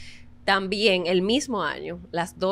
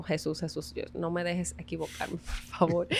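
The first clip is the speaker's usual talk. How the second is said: quiet retold prayer; 7 dB quieter than usual. Oración interna antes de audición.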